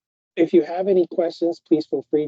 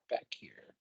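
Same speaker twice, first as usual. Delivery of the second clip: whispering; 21 dB quieter than usual. Real, not acted. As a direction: drop to a whisper. Kid is right beside him.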